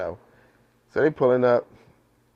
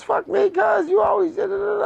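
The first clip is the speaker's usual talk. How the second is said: much higher, shouted rant; mock cousin yelling; 3 dB louder than usual. Cousin yelling back after he cursed her, shouting. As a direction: rant back at him at full pitch.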